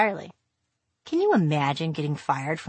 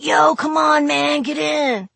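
dude voice; 9 semitones higher, 4 dB louder, slower than usual. Retold or imitated. Bandmates calling from the van.

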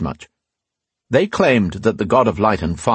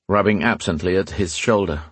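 ordinary delivery, nothing marked

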